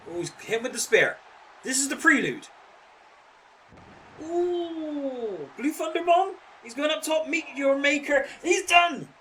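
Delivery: excited high play-by-play call; wrestling commentator. Acted. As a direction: call the finisher like a ringside announcer. Calls the match finish live.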